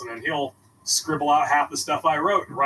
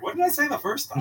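high mock puzzled self; 9 semitones higher than usual. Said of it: "you're like".

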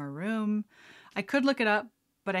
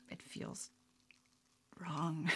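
hushed; 2 semitones lower, 10 dB quieter, much slower than usual. Retold or imitated. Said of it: "So I'm like".

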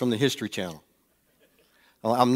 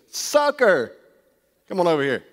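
sly salesman's crow, pitched up high; greedy car salesman; 4 semitones higher than usual. Retold or imitated. Car salesman spotting an eager buyer, high sly call. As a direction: gloat like a salesman who's spotted a mark. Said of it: "He goes".

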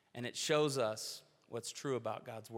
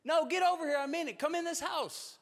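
much higher, louder calling out the front door; retold yell; 13 semitones higher, 5 dB louder than usual. Wife calling neighbors in from the front door, loud yell. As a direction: holler to the passers-by to come in. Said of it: "and she yells".

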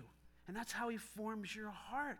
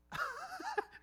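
nervous laugh. Real, not acted. Laugh after retelling his nerves.

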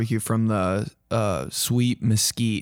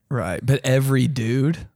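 ordinary talk, nothing marked

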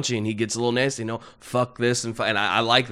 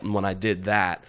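ordinary talk

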